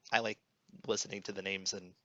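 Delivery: usual speaking voice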